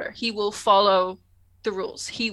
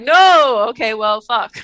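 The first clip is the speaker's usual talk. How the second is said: mock dismay; 11 dB louder, slower than usual. Host as Picard losing faith, mock dismay. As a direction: wail it, then give in. Voices Picard's exasperated reaction.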